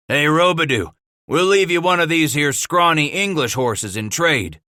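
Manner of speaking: mock offer called across the room; cried out. A narrator's reading. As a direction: call it out to tease. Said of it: "Sweet cried".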